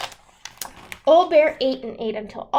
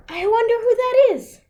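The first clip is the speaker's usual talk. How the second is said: put-on old woman voice; 10 semitones higher, 6 dB louder than usual. A narrator's reading. Brena wonders at knock.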